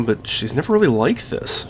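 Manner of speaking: usual speaking voice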